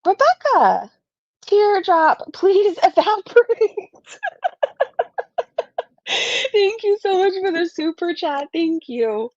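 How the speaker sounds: reads the name high and loud, breaking into a laugh; half-laughing as she reads out a silly name; giggly high read. Real, not acted. To the viewer Rebecca teardrop, thanking her for the super chat, with a laughing high voice.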